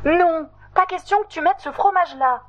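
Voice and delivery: sharp high refusal. Acted. Meredith refusing Totoche's cheese, high and insistent. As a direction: put your foot down. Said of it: "insiste Meredith".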